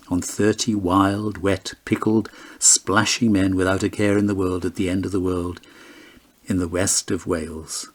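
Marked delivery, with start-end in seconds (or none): none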